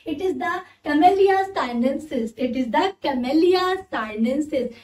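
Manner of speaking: camellia sinensis drawn out, each syllable clear; slow careful pronunciation. Shown as a demonstration. Teacher modelling the tea plant name slowly. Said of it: "the scientific name of tea leaf is".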